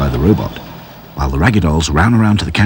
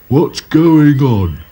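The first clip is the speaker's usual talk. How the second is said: demanding; 6 semitones higher, much slower than usual. A narrator's reading. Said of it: "demanded Sad Sack".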